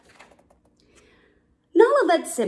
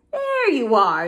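louder, drawn-out call; calling from above; 3 semitones higher, 5 dB louder, slower than usual. A narrator's reading. Zazu spotting returning cubs from above, calling. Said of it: "Zazu called from above".

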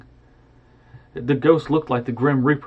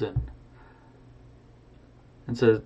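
ordinary delivery, nothing marked

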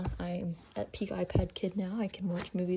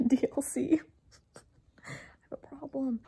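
whispering; 6 semitones higher than usual. Real, not acted. Sheepish confession.

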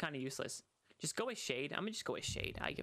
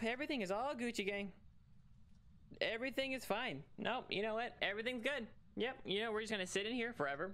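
mock cheerful reassurance; 6 semitones higher than usual. Hiding in closet, nervous reassurance.

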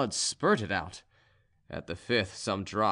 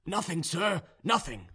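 Ivan, placating serf; 7 semitones higher than usual. A narrator's reading.

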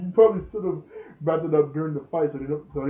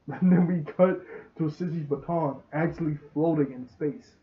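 ordinary delivery

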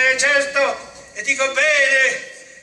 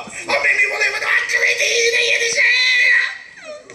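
tearful shout; 9 semitones higher, 6 dB louder than usual. Acted. Complaining tearfully of being attacked.

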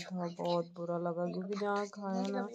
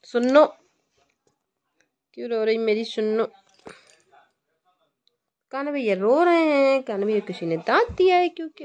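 calling out; 6 semitones higher, 15 dB louder than usual. Real, not acted. Mother calls to crying son.

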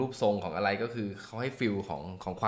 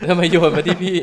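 laughing protest; 8 semitones higher, 10 dB louder than usual. Real, not acted. Handed the hard reading unexpectedly.

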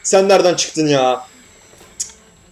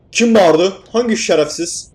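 startled shout; 5 semitones higher, 3 dB louder, slower than usual. Real, not acted. Startled by a scream in game.

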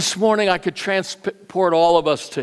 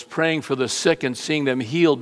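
ordinary delivery

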